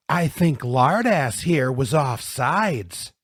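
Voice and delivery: taunting accusation. Acted. Bully pointing down at Louis, loudly mocking. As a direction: announce the blame for everyone to hear. Bully blames his victim.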